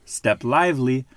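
plain reading voice

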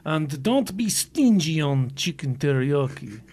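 put-on slow, hammy Russian accent; bad Russian accent. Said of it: "saying Subway sandwich things in a bad Russian accent".

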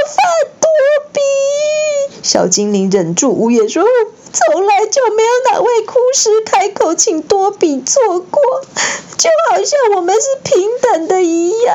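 high tearful elf cry. A narrator's reading. Dobby crying out to Harry, high voice. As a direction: choke it out through tears.

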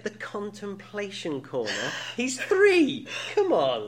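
high, loud incredulous outburst. Retold or imitated. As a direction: repeat it back in loud disbelief. Incredulous reaction to the quote.

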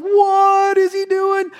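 very high, stretched-out squeal of alarm; hammed-up incredulous dismay, drawn out; mock disbelief. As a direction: wail it like an aghast onlooker. Vacationer aghast at the stunt.

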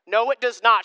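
ordinary talk

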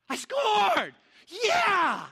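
high, loud cheer; triumphant yell. Retold or imitated. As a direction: shout for joy at scoring. Celebrating his goal.

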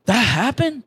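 a high, startled "that happened?"; retold disbelief. Telling the congregation his shock at the coworker's news, in stunned disbelief. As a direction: caught off guard, half laughing at it.

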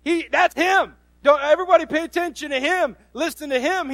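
high-pitched, emphatic proclamation; God. Acted. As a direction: announce him proudly to everyone. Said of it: "God stood up and said".

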